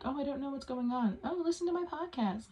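squeaky high mock plea; high put-on voice begging listeners. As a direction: pitch it way up, teasing. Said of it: "if anybody were to".

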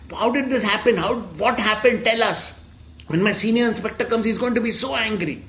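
lightly acted shouting interrogation; mock angry shouting. Constable demanding answers from the prisoners, mild mock shouting. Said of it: "one constable was shouting at the prisoners".

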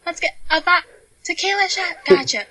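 high-pitched, chatty bar-worker impression; Craig the bartender. Bartender taking a drink order over club noise, high impression. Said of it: "That is what he would sound like".

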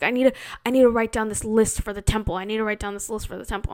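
fast, rattled-off mock auntie; mock hurried auntie. Auntie explaining why she needs the laptop, fast mock rush. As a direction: rush it out like an urgent errand.

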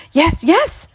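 high, loud yes yes; excited cry. Acted. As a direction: jump up and down crying it. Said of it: "cried the old man".

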